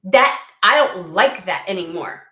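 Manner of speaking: loud, testy mock retort; pithy retort. Querent rejecting what Spirit threw their way, pithy retort. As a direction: snap back at them, acted. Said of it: "aren't you just getting pithy with them".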